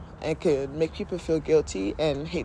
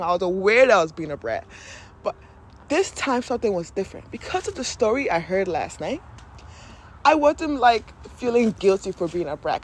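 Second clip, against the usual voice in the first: normal talking voice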